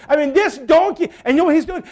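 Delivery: ordinary talk, nothing marked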